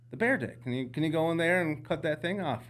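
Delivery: high, loud, eager ask; excited insistence. Retold or imitated. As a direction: push the request eagerly. Insisting to guide, retold.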